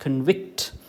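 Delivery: convict as a slow con-VICT; stress on second syllable. Shown as a demonstration. Teacher summing up the verb, con-VICT for convict. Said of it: "but vict is stressed".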